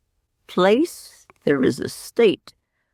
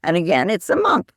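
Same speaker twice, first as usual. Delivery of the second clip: ordinary talk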